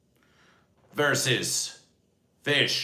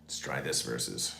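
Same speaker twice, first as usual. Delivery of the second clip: deep test voice; 9 semitones lower, 7 dB quieter than usual. Shown as a demonstration. Testing new mic voices.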